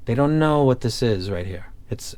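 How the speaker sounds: ordinary delivery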